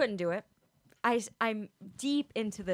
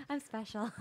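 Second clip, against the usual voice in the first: ditzy voice; 6 dB quieter than usual. Retold or imitated. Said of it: "sound a little vapid".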